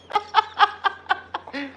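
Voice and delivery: squeaky burst of laughter; high giggle. Real, not acted. Cracking up at his own joke, high giggle. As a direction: let a high giggle escape.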